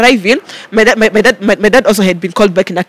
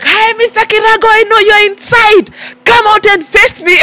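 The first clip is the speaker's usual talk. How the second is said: retold shouting; 11 semitones higher than usual. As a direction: call him out from outside the office. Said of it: "Oh, you're shouting".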